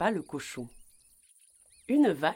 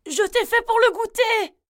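loud high-pitched cry; grandmother shouting; 9 dB louder than usual. A narrator's reading. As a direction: call out urgently as an old grandmother. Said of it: "cria la grand-mère".

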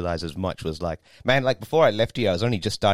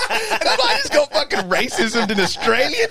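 laughing through the punchline, much higher; 6 dB louder than usual. Real, not acted. Comedian telling friends the punchline, laughing through it. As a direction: crack up while you tell it. Retelling the racist encounter.

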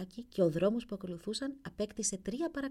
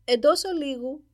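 loud, higher call of «Εντός ολίγου» from behind a door; prince's voice from the ice; 6 semitones higher, 10 dB louder, slower than usual. A narrator's reading. Ice on the window answers the courtiers, calling out loud. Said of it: "με τη φωνή του πρίγκιπα".

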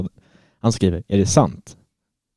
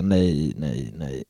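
quiet despairing no; 7 semitones lower, 8 dB quieter, much slower than usual. Retold or imitated. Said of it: "hör jag ju någon fotograf säga".

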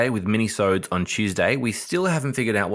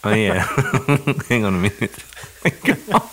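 laughing; 3 semitones higher, 4 dB louder than usual. Real, not acted. Laughing, regrouping.

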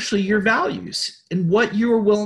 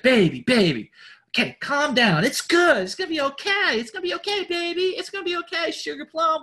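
put-on soothing boyfriend; 7 semitones higher than usual. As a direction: gently talk a panicking partner down. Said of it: "and he's like".

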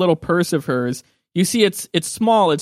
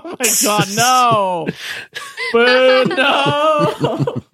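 loud, high heckling through laughter; real outraged groaning; 8 semitones higher, 4 dB louder, much slower than usual. Real, not acted. Game master booing a player's crude rhyme, loud laughing protest. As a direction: boo the joke, laughing in protest.

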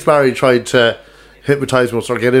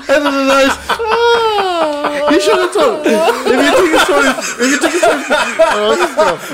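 high, broken words through giggles; helpless laughing; 13 semitones higher than usual. Real, not acted. Trying to admit the story flopped, laughing helplessly. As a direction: keep restarting, too helpless with laughter to finish.

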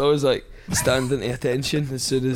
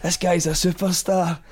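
mild mock shout, raised pitch; imitated shouting; 5 semitones higher than usual. Boss showing off new worker to the tradesmen, mild mock shout. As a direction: announce it like the shouty boss, without full volume. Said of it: "he's shouting at all these guys going".

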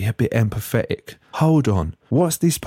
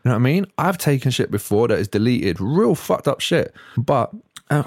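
usual speaking voice

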